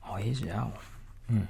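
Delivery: ordinary delivery, nothing marked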